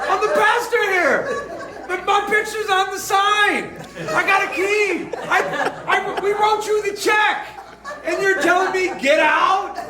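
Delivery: high, slow, half-laughing outrage; mock indignation. Pastor protesting he owns the building, high mock outrage. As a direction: insist on your authority, amused.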